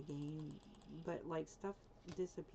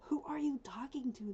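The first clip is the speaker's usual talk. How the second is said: voicing the dog in a higher whisper; 7 semitones higher, 7 dB louder than usual. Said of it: "My dog's like".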